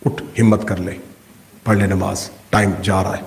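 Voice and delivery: low, soft urging; lightly voiced conscience, low and soft; inner voice. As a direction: murmur it like a conscience nudging. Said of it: "پھر اندر سے آواز آئی".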